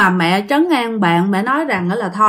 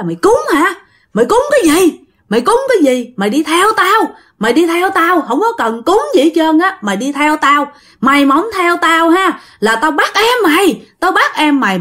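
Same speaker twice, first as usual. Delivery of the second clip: ghost cursing; 7 semitones higher than usual. Acted. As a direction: bark it out like a furious ghost. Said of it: "ổng cứ chửi bạn". (hồn ma quát mắng giận dữ)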